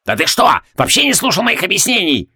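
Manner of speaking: put-on high-pitched outburst from an old crank; exasperated. A narrator's reading. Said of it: "в сердцах швырнул".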